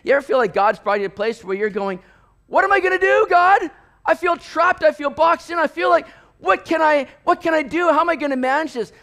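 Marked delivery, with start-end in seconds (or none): 2.5-8.9 high, loud cry to God; urgent, overwhelmed pleading; desperate plea. Acted. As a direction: act out feeling trapped and frantic.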